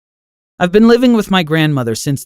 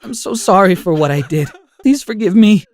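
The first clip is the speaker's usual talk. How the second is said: mumbling through tears; 8 semitones higher, 4 dB louder than usual. A narrator's reading. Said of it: "I started mumbling through my tears".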